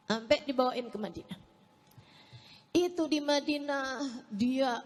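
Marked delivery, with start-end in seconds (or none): none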